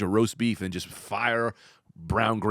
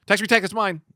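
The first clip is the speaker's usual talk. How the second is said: high, loud claiming shout; co-host mocking his partner's dibs, much higher; calling dibs; 10 semitones higher, 5 dB louder than usual. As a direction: call dibs like a kid. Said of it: "you said it like you called it".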